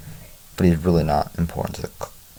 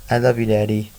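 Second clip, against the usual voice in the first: lightly voiced little boy, higher pitch; a hint of a toddler, pitched up; small child's affection; 4 semitones higher than usual. A narrator's reading. Two-year-old to his father, small child's affection.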